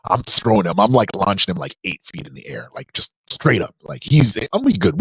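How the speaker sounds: normal talking voice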